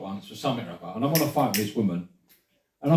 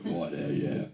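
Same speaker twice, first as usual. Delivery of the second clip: much lower, softer heavy voice; fat sounding voice; 12 semitones lower, 10 dB quieter, slower than usual. Retold or imitated. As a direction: sound like you just ate a burger. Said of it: "if the bird answer phone went".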